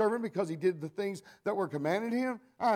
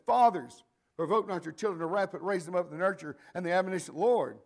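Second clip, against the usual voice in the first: scripture reading; 2 semitones higher, 5 dB louder than usual. Acted. Quoting scripture from the pulpit.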